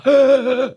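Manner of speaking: a sudden high, loud gasp; sharp gasp for air. Retold or imitated. Waking up gasping as air is pulled out. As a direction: suck in air like your breath was ripped out. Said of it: "It was like a".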